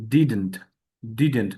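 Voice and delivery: "didn't" short and falling; correct pronunciation. Shown as a demonstration. Teacher modelling the true sound, short clean "didn't". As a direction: say "didn't" compactly as "DID-nt". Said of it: "উচ্চারণটা এটা হবে".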